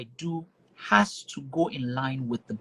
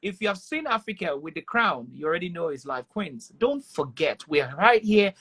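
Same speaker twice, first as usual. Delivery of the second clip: voice-over promo voice; 4 semitones higher than usual. Pretend. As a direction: sell the show like a radio ad. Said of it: "In your best Niger Brand Chick voice".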